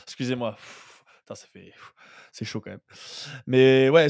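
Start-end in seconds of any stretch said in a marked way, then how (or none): 0.5-2.8 exasperated sighing mutter under breath; scornful sigh. Real, not acted. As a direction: blow out air, annoyed at yourself. Muttered sigh after naming slip.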